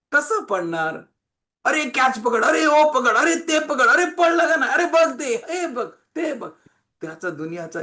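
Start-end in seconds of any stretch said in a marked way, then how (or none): 1.6-6.5 high, loud, excited calls; urgent mock cries in quick succession; mimicked playground shouting. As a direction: yell to teammates mid-game, imitated. Acts out noisy players shouting.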